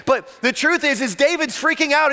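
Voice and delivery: ordinary talk, nothing marked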